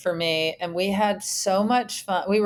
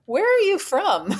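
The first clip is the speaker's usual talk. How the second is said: high-pitched, baffled question; puzzled neighbor; 11 semitones higher, 3 dB louder than usual. Retold or imitated. Neighbor questioning the new roommate's accent, puzzled high voice. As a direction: ask it high, as a nosy newcomer. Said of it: "the guy was like".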